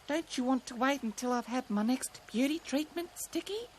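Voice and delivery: high, soft rabbit voice; gentle cartoon rabbit; rabbit character. A narrator's reading. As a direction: ask sweetly, high and soft.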